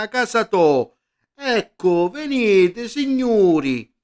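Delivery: loud, high Sicilian barker's call; sicilian accent. Acted. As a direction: bark it out like a fairground crier. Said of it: "voce dal megafono gridare in siciliano". (imbonitore siciliano al megafono)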